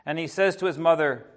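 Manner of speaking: usual speaking voice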